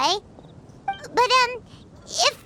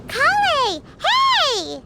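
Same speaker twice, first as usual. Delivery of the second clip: calling out; much slower than usual. Acted. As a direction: shout her name across the square. Calling to Collei across square.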